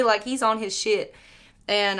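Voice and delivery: usual speaking voice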